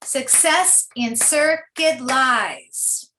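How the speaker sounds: "Success in circuit lies" drawn out slowly in iambic pulses; slow metrical "Success in circuit lies"; read with beat. Shown as a demonstration. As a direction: stress every second syllable of "Success in circuit lies". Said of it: "as I read it with a beat".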